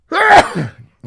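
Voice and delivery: another sharp sneeze; loud sneeze. Real, not acted. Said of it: "Oh, excuse me again".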